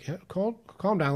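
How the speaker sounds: a lightly voiced coach reining him in; calming coach. Coach calling to a struggling runner, urgent and calming.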